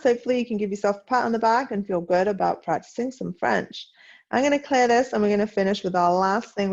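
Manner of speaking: usual speaking voice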